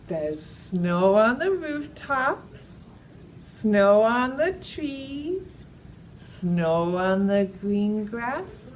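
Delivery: slow singsong rhyme for toddlers; drawn out, playful storytime chant; slow action rhyme. Acted.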